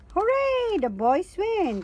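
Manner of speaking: high, loud hooray; dog cheering the boys' win; victory cry. A narrator's reading. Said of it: "cries Danny".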